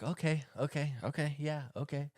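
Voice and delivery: soft placating okays; wife softly backing off the argument; soothing okays. Retold or imitated. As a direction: humor him gently, let it go. Wife humoring her husband.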